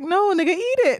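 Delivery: high-pitched mock urging; urging the cat. Owner retelling telling the cat to eat a bug, high urging. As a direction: egg the cat on, pitch jumping up.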